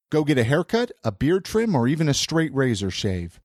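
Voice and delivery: normal talking voice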